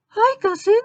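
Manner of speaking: very high, loud squeaky greeting; Supergirl. Acted. Supergirl greets Batman, squeaky girlish voice.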